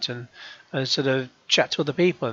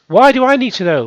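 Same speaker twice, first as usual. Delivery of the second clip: exasperated; 7 semitones higher, 6 dB louder than usual. Real, not acted. Ranting at Twitter.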